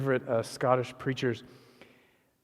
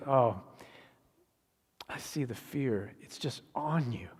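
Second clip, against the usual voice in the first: soft, understanding voice; gentle compassion; 5 dB quieter than usual. Acted. Preacher as Jesus seeing the disciples' fear, gentle. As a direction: drop to a soft, tender hush.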